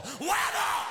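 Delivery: very high shouted "Whammo!"; crash sound word. Acted. Comic imitates his kid hitting a pole, very high shout. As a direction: yell it like the impact of a crash.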